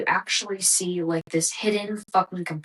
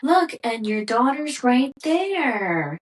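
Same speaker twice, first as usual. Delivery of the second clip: higher, louder, slow taunting sing-song; mock-imitating someone poking the bear; 7 semitones higher, 4 dB louder, slower than usual. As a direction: needle her like a smug instigator. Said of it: "being like".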